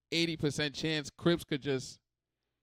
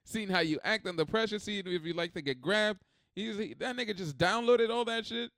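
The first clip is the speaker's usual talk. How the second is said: normal talking voice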